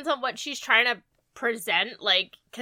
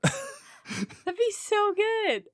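delighted; 10 semitones higher, much slower than usual. Real, not acted. Delighted at Troy hamming it up.

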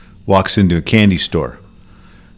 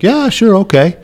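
much higher, skeptical go-along; doubtful clerk; 12 semitones higher than usual. Acted. Store clerk agreeing to the magic trick, high skeptical voice. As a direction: humor him, not convinced.

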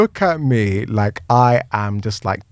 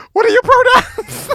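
mock squeal. Storyteller joking about police questions, mock squeal.

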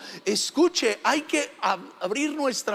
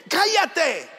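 raised, mock scolding shout; higher-pitched order to be silent; retold shout; 6 semitones higher than usual. Preacher voicing the crowd silencing the beggar, mock shout. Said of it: "muchos le gritaban".

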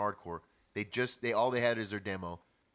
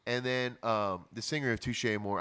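usual speaking voice

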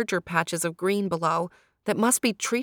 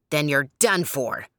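menacing shriek; 3 semitones lower, 3 dB louder than usual. A narrator's reading. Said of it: "he shrieked".

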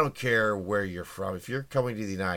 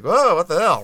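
much higher, much louder mock shock; shocked exclamation; 10 semitones higher, 11 dB louder than usual. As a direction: blurt it out like the governor seeing a theft. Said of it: "He was like".

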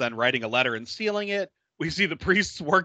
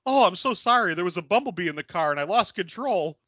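apologetic driver; 4 semitones higher than usual. Retold or imitated. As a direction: gush out the excuse up high. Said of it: "he's like".

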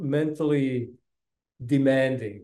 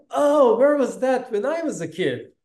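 high, louder, playfully longing; mock wistful exclamation; 10 semitones higher, 5 dB louder than usual. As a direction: exclaim it like a kid who missed out. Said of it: "It was like".

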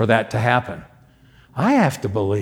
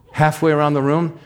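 normal talking voice

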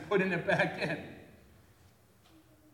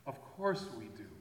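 softer, rueful repeat; a hushed, honest admission; quiet confession; 4 semitones lower, 10 dB quieter than usual. Shown as a demonstration. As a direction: let it sink in quietly. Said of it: "repeated admission".

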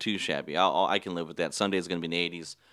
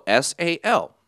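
letters spelled out one by one, louder; spelling it out; 8 semitones higher, 5 dB louder, slower than usual. Retold or imitated. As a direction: spell each letter separately, pitched up. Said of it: "I spelled it out".

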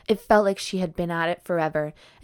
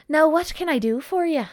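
leprechaun voice; 10 semitones higher, 3 dB louder than usual. Acted. Voicing the leprechaun.

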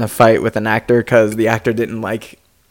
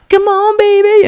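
high, wild Joker voice; crazy Joker. Retold or imitated. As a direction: play it like a crazed Joker. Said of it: "acting all crazy".

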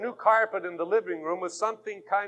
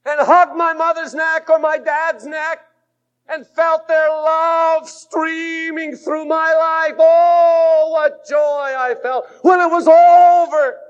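loud, high, slow pulpit shout; fiery revival preacher; preaching at full force; 9 semitones higher, 10 dB louder, slower than usual. Acted. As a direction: lift the whole room with it.

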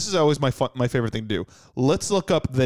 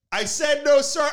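mock panicked diner; 13 semitones higher than usual. Startled diner refusing the waiter, high panicked voice.

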